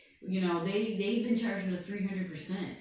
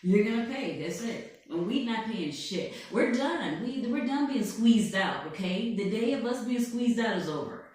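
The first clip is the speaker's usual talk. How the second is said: boastful blustering tycoon voice, put on all through; Donald Trump; 4 dB louder than usual. President boasting to an interviewer, put-on blustering voice. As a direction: bluff and brag like a showman president. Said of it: "I'm Donald Trump".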